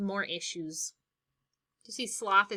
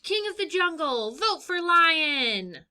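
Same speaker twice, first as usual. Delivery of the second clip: proud, high candidate slogan with a slow vote for lion; campaign slogan; 7 semitones higher, 7 dB louder than usual. Acted. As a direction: announce it like a campaign ad.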